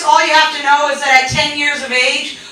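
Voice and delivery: ordinary delivery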